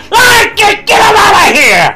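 high, loud shrieking cry. Acted. Unclean spirits crying out to Philip, in a loud high shout. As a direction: scream it out like a tormented spirit. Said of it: "unclean spirits crying with loud voices".

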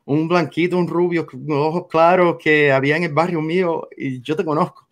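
ordinary talk, nothing marked

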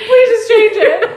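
pleading with her husband to turn off the sad movie; mock tearful plea. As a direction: beg him through mock tears. Begging husband to change movie.

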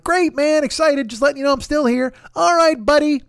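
high-pitched, over-eager fake cheer; mock cheerful. Texting the dude to check in, hammed-up cheerful. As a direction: chirp it like a relentlessly upbeat pal. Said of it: "All that fucking cheerful goddamn".

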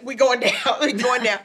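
mock panic with a laugh; half-laughing cry that the boat is sinking; rocking boat, mock panic while laughing; laughing alarm. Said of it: "me and Byron are like".

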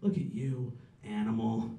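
low, quiet mock contempt; self-disgust. Scolding oneself in disgust.

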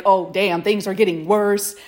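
ordinary talk

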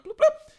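very high, loud bloop noise; imitated trunk bloop. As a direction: pop out two squeaky bloops for a car trunk.

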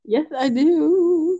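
high, drawn-out denial; playful protest. Pretend. Co-host denying they ignore advice, playful protest.

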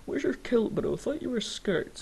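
high, teasing tourist voice; lightly voiced mocking outsider. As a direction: mimic a clueless tourist teasing a Scot. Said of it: "if someone comes up to me like".

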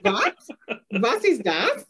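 badly faked German accent, mock bewilderment. Guest teasing the host over her name, mock German accent. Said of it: "this fake German accent really badly".

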